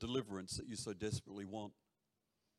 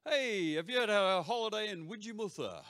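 loud, high, rising-pitched playful call-out; mock casual question; 9 semitones higher, 7 dB louder than usual. Preacher modelling a joke question for the congregation, playfully loud. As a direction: toss it out loud as a cheeky question. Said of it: "when you're out with a friend or at work, say".

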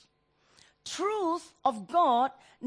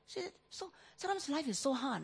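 tearful; 3 semitones higher, 7 dB quieter than usual. Real, not acted. Crying heard on the line.

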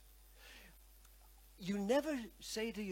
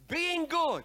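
louder, higher push on good; preacher demanding more gusto from the congregation; 7 semitones higher, 10 dB louder than usual. Shown as a demonstration. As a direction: lift the crowd with a louder being good. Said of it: "Can you say it with a bit more gusto than that?".

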